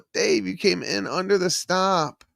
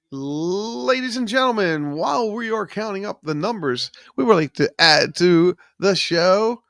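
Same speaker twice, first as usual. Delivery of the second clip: stretched-out mock announcer intro, pitched up; show announcer; 4 semitones higher than usual. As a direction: ham it up like a variety-show emcee.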